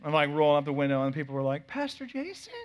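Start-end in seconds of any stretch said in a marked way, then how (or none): 1.7-2.5 high laughing mock question; lightly voiced teasing bystanders; teasing onlookers. Onlookers tease him.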